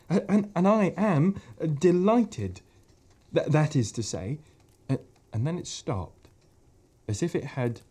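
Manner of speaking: a shy creature tripping over his words; stammering fawn. Acted. Fawn Tumnus speaks.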